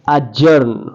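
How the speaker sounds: अजर्न said as ajern, d dropped; silent d. Shown as a demonstration. Teacher showing students the silent d in adjourn. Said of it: "डी को pronounce नहीं किया जाता".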